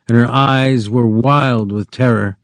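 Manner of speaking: plain reading voice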